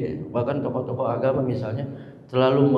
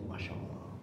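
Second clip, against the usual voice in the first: breathless whispered awe; choked whisper; 19 dB quieter than usual. Real, not acted. Preacher overcome recalling the hajj, whispering. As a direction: let it catch in the throat. Emotional remembrance.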